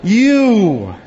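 "You" punched out loud and alone; stress on You. Shown as a demonstration. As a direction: hit the word "You" hard and let it ring. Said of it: "You is the emphasis".

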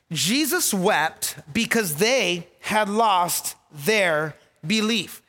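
deliberate repeat, weighting each phrase of "Jesus wept because they had lost their belief"; slow dictation. Real, not acted. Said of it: "write this down".

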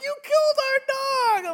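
mock-accuse him like a sobbing child; kids bawling. Kids crying at dad after the dog fight, high mock wail. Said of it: "my kids just start bawling".

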